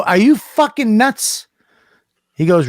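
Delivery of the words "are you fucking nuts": high, slow disbelief; acted-out appalled question; incredulous protest. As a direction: stretch it out in high-pitched outrage. Retold protest at a dare.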